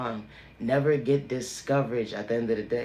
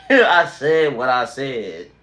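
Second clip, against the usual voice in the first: laughing defiantly; 11 dB louder, much slower than usual. Real, not acted. Defiant sign-off after a rant.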